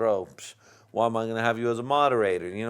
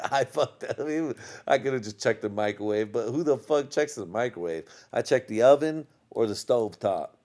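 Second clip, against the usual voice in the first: ordinary talk, nothing marked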